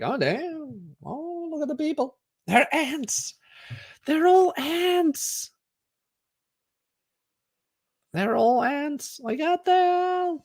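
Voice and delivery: high, slowed, marvelling voice; mock amazement high up; awed view from above. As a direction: gaze down in wonder at the tiny people. Retelling view from the height.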